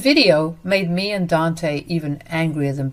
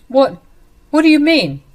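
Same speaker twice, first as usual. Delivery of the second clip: higher, louder, rattled; panicked; 9 semitones higher, 7 dB louder than usual. Acted. Liam is caught off guard by Dante's threat, panicking. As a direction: Liam hears he will be arrested, panicked and higher.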